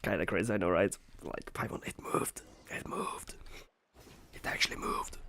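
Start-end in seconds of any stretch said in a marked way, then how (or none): 1.2-5.2 hushed awed whisper; breathless whispered awe. Retold or imitated. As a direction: whisper it like a secret wonder. Awed retelling to Paimon.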